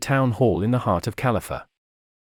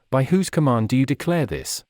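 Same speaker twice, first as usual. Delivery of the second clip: ordinary delivery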